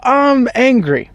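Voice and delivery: high, slow hammed-up anger; mock angry. Pharaoh in his grief, slow mock-angry declaration. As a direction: ham up a childish tantrum, drawn out. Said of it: "Second stage of grief hit".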